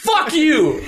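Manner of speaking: very high-pitched mock outburst; sudden drunk indignation, hammed up; imitated drunk. Drunk friend snubbed in the garage, high mock outburst. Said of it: "comes in there all drunk and goes".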